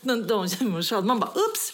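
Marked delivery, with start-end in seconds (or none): 1.3-1.7 very high little squeak; high-pitched comic realisation; oops. Retold or imitated. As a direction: toss out a mock-innocent oops. Retold realisation children were forgotten.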